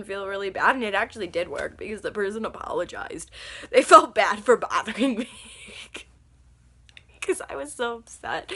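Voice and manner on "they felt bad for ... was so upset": giggling through the words; amused, breaking up mid-sentence; laughing. Real, not acted. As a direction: laugh while telling it. Laughing at her own prank.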